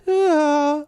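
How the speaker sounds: high, loud drawn-out "ah"; imitated ghostly female cry. Demonstrating the mystery sound to co-host, imitated high cry. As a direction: mimic a high voice caught on a recording. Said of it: "It sounds like, because it's like a".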